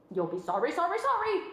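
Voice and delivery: high, loud goose warning; sing-song goose warning; calling goose. A narrator's reading. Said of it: "called the goose".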